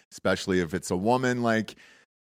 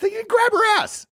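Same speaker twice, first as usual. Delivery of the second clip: mock high-pitched outrage; sarcastic squeal; 5 dB louder than usual.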